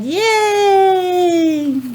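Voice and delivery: high happy cheer. Real, not acted. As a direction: celebrate the right answer. Child cheers at right answer.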